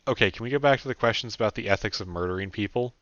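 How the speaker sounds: plain reading voice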